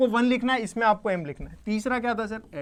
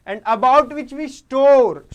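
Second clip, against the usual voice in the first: "about" and "store" drawn out, loud and higher; slow, raised-voice emphasis on "about" and "store"; emphatic key phrase; 6 semitones higher, 12 dB louder, much slower than usual. Real, not acted. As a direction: drive the phrase home, stretching "store" long and loud.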